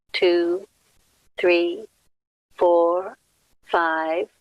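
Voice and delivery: measured count, a pause after every number; breathing count. Acted. Leader counts breaths out for everyone, measured meditation count. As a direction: pace a group's breath, one drawn-out word at a time.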